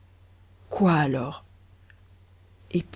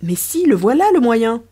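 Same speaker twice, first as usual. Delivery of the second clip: louder, eager discovery; sudden idea; 3 semitones higher, 6 dB louder than usual. Acted. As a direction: burst out with the solution. Riquet gets an idea.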